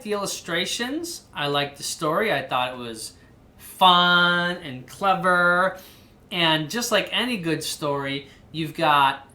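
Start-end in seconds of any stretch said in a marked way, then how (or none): 3.6-5.8 very slow, stretched fun and clever; drawn-out praise. Real, not acted. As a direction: linger on each word. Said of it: "praising book".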